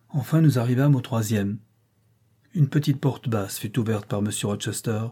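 ordinary delivery, nothing marked

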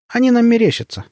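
high-pitched, not quite convinced; unsure explanation. A narrator's reading. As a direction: explain it away without much confidence. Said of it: "не очень уверенно объяснила Хохлова".

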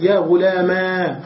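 "غلاما" with a long final aa; alif ending. Shown as a demonstration. Teacher reading the alif form, long aa ending. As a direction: hold the open aa of "غلاما". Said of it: "وبالألف".

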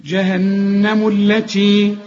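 "جهنم التي" chanted slowly, the mim flowing into the lam; slow melodic tajweed "جهنم التي"; joined recitation. Shown as a demonstration. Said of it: "همزة الوصل ... تسقط".

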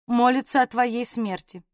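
usual speaking voice

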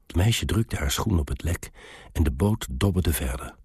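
ordinary delivery, nothing marked

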